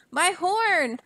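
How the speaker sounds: high, startled wail; dismayed cry. A narrator's reading. Trumpeter finding his horn clogged, dismayed cry. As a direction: exclaim in dismay over the horn.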